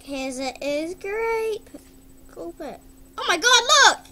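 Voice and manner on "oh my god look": high, loud delighted shout; excited. Real, not acted. Showing off the blue effect, a loud excited cry. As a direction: call out in surprise.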